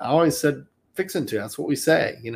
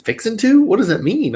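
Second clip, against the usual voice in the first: puzzled question; 11 semitones higher, 3 dB louder than usual. Retold or imitated. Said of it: "He said".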